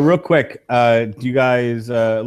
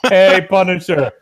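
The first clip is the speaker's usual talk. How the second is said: hammed-up villain pun, a touch Arnold; Mister Freeze impression; 5 dB louder, slower than usual. Imagining Arnold as Freeze taunting the Punisher, loud mock villain. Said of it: "just do all of his lines from Batman and Robin".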